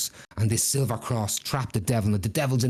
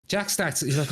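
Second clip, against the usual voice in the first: ordinary delivery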